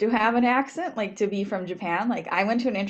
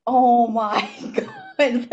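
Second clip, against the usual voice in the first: drawn-out, louder, higher, laughing disbelief; shocked laughing; 4 semitones higher, 6 dB louder, much slower than usual. Retold or imitated. Guest recalling her shock at the grandmother's remark, shocked laughing. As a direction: stretch it out slowly, breaking into laughter. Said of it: "I was, like, so shocked".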